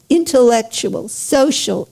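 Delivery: ordinary delivery, nothing marked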